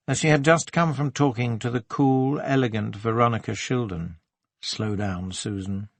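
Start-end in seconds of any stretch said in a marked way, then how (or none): 4.6-5.9 soft, lowered inner caution; warning herself. A narrator's reading. Woman cautions herself after saying too much, softly warning. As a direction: rein yourself in under your voice. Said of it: "she warned herself".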